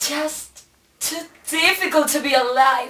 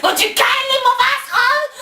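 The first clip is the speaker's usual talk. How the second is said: high-pitched angry outburst; exasperated scream; 10 semitones higher, 3 dB louder, faster than usual. Acted. Shopper to the person blocking the tuna, exasperated scream.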